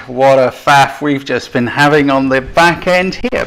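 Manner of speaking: mock calm. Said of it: "He said very calmly indeed".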